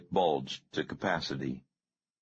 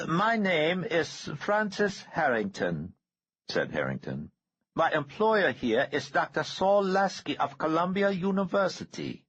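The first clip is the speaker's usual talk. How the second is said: a creepy high-pitched killer, savoring it; eerie high villain voice; 13 semitones higher than usual. A narrator's reading. Harrington's voice.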